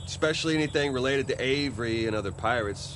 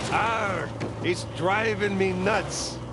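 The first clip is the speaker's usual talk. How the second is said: slow, high hammy pirate growl; 6 semitones higher, much slower than usual. Retold or imitated. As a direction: drag out the punchline like a pirate.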